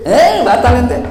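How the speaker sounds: high burst of laughter, then laughing words; a teacher acting out laughter; 1 laughing demo. Shown as a demonstration. Demonstrating laughing in prayer.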